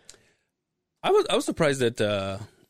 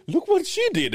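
high-pitched mock excitement; much higher, hammed-up reveal; excited showing-off. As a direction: imitate someone eagerly showing it off. Acts out someone showing off tattoo.

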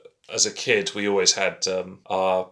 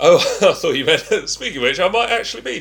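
high, laughing through the words; giggling as the joke lands; 9 semitones higher, 5 dB louder, faster than usual. Real, not acted. Joking about being Nicola Sturgeon, laughing.

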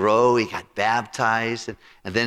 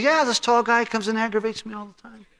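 imitating the old friend; 13 semitones higher than usual. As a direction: play him as a fed-up, grumbling man in a high voice. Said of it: "He goes".

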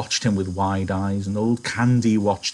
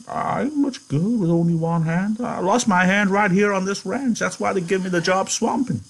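put-on much higher, quavering old man; old Candy; 9 semitones higher than usual. Candy asking George about the farm, old and hopeful.

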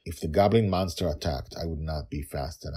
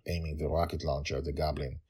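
usual speaking voice

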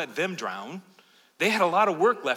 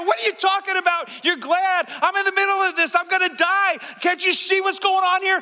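high-pitched, panicked yelling; shouting over a storm; 8 dB louder than usual. Acted. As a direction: yell in desperation through the gale. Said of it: "I can't hear you".

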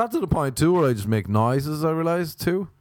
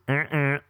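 short two-note honk; vocal car-horn imitation; car horn noise; 4 semitones higher than usual. Shown as a demonstration.

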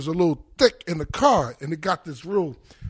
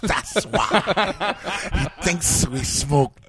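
laughing through the words; much slower than usual. Retold or imitated. [name] joking about dust.

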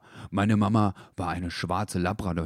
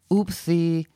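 much higher, louder startled oops; oopsy; 10 semitones higher, 5 dB louder than usual. A narrator's reading. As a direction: catch yourself with a squeaky oops. Dackel fängt Füchsin auf. (überraschtes Upsi, viel höher)

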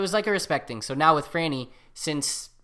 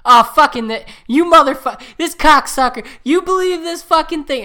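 loud, high furious cursing; swearing outburst; 12 semitones higher, 11 dB louder than usual. Retold or imitated. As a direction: rage at the screen, all out. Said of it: "And it's".

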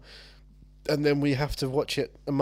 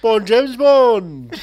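loud, high, very drawn-out impression; Sean Connery impression; 4 semitones higher, 8 dB louder, much slower than usual. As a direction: announce it slowly in a hammed-up voice. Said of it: "It's me, Sean Connery".

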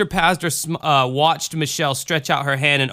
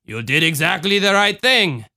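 put-on lilting Cajun accent; reassuring Cajun mother; 5 semitones higher than usual. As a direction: reassure a child in Cajun tones. Voices Ma Marie.

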